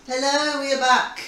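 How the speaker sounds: bright, loud hello to the viewers; excited greeting. Real, not acted. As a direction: throw a big cheerful welcome out. Cheery welcome back to viewers.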